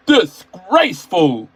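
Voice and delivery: loud, slow disgusted scold. A narrator's reading. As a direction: drag it out in disapproval. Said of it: "scolded Gordon".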